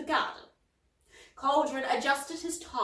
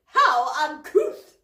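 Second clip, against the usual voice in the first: indignant; 6 semitones higher, 11 dB louder, slower than usual. Acted. Polwarth shocked by rude word.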